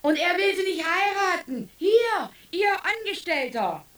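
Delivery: high, loud outraged complaint; indignant accusation. Acted. Mother denounces the young doctor to his boss, loud and outraged. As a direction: point at him and accuse.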